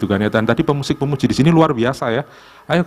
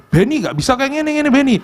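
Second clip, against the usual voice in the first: mimicking sharp coordinators; 10 semitones higher than usual. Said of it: "Mereka itu tajam-tajam loh pikirannya".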